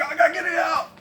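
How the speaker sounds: high, loud frantic cry; panicked shout. Retold or imitated. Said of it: "I'm like".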